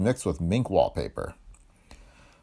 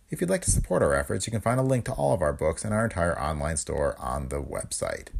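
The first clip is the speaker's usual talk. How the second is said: ordinary delivery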